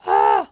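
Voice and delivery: short loud scared cry; startled shriek. Real, not acted. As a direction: jump back with a yelp. Startled by shark.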